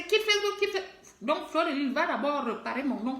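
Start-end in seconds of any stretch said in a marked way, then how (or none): 0.0-0.9 high, quick mimicry of an order; mock someone telling you to leave; higher-pitched quoted command; mimicked dismissal. Mimics people ordering her off Facebook.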